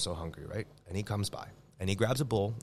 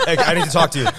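mock Italian talk; 7 semitones higher, 11 dB louder, faster than usual. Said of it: "That's how all of Italians talk".

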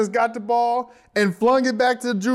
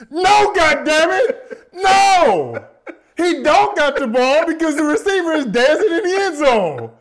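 mock furious coach shout, higher and louder; 8 semitones higher, 5 dB louder than usual. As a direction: roar at the player who bit on the fake. Coach yelling at his safety.

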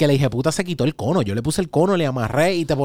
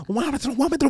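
quiet rage; 11 semitones higher, much faster than usual. Retold or imitated. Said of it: "esto era todo así bajito".

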